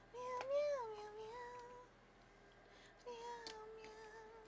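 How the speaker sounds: high, soft cat meows; singsong meowing. Pretend. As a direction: meow quietly in a very high voice. Singsong cat noises while working.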